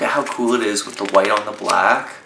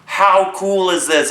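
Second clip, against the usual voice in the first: excited; 9 semitones higher, 4 dB louder, slower than usual. Real, not acted. Excited zine reviewer gushing.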